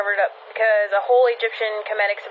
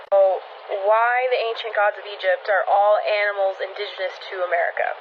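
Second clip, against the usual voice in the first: usual speaking voice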